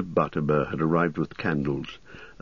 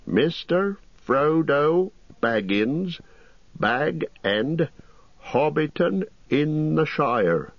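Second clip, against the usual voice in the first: slow, higher, proud reading aloud; 10 semitones higher, much slower than usual. Acted. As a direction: read the address out slowly, savouring each word. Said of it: "reading out the address slowly and proudly".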